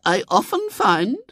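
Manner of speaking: very high, slow, measured lady's voice; preachy lady. A narrator's reading. Lady lectures William on drunkards, preachy. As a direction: open a moral lecture.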